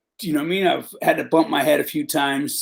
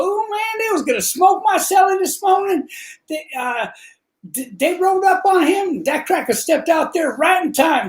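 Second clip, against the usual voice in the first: much higher, strained put-on voice with a shout; mock inmate voice; 12 semitones higher than usual. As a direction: act out the excited inmate's story, pitched way up. Inmate retelling the attack.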